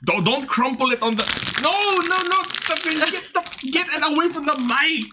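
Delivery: high, urgent pleading no; panicked protest. Real, not acted. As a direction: stop them crinkling, voice jumping up high. Protesting crinkling near mic.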